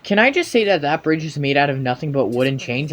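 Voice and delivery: ordinary delivery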